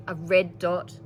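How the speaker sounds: usual speaking voice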